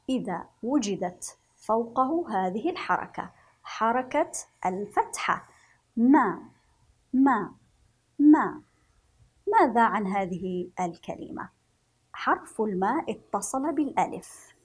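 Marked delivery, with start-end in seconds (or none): 6.0-8.6 short MA, "ما" cut off quickly; short vowel. Shown as a demonstration. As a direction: say "ما" with a brief, louder vowel. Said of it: "له صوت قصير". ("ما" بصوت قصير وقوي)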